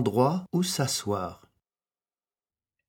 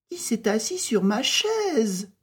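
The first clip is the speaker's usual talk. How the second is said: higher mother bear asking; a lightly voiced mama bear; 11 semitones higher than usual. A narrator's reading. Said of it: "demanda Maman Ours". (voix aiguë de Maman Ours)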